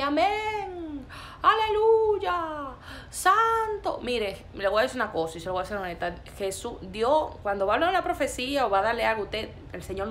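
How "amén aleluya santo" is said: high, loud drawn-out church cries; mock congregation shouting praise. Preacher mimicking people who cheer a false prophet, mock praise shouts. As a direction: imitate an excited crowd crying out praise. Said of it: "toda la gente".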